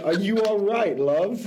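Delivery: put-on half-playful British accent copying the crow; imitating British crow. Host imitates the crow for the co-host in mock British. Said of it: "he's saying".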